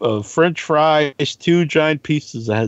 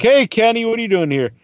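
higher-pitched surprised hello; retold friendly greeting; 9 semitones higher than usual. Podcaster greeting his neighbor in a story, pleasantly surprised. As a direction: greet a neighbor high and casual.

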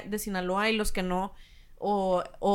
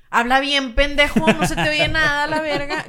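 mock loud yell; 3 semitones higher, 6 dB louder than usual. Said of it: "hablan bien bajito".

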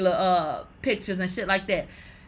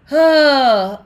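ordinary delivery

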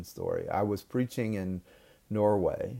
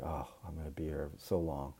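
much softer and lower, worn out; tired sigh; 6 semitones lower, 11 dB quieter than usual. Retold or imitated. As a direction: mutter it to yourself, drained. Exhausted preacher, retold thought.

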